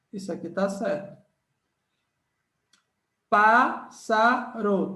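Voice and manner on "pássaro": very slow word split into syllables. Shown as a demonstration. Teacher checking the split with students, very slow. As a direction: spell out each syllable slowly. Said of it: "tá errado".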